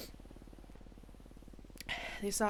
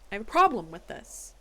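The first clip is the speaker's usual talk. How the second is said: louder, firm complaint with PROBLEM punched; loud objection; 8 dB louder than usual. Real, not acted. Judge loudly objecting to the case report, firm.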